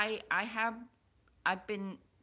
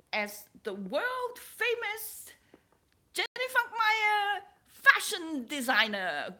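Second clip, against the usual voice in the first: eager plea; 14 semitones higher, 9 dB louder than usual. Retold or imitated. Quoting her eager plea to spirit guide.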